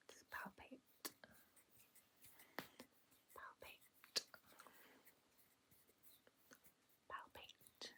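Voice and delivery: slow, hushed whisper. Pretend. Examiner palpating the listener's jaw, whispering. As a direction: whisper the word slowly while working.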